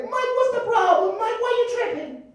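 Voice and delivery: very high squeaky plea; mock innocent pleading; falsetto nephew. Nephew pleading innocence to his uncle, very high voice.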